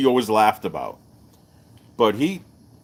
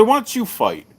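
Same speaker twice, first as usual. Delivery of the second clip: higher, throwing it back; a teasing comeback; retort; 11 semitones higher than usual. Retold or imitated. Retold retort to Bill.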